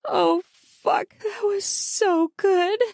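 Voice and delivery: high, slow satisfied sigh; soft gratified voice. Acted. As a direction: savour it, blissful and drawn out. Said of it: "I said in a soft, gratified voice".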